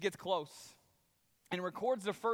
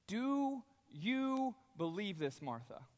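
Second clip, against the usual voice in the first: slow searching question; 3 dB quieter, slower than usual. Acted. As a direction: draw it out slowly, gently probing. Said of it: "he asked this question".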